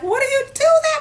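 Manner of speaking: hammed-up furious scream; retold screaming. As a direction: scream it down the phone. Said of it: "I was crying and screaming on the phone".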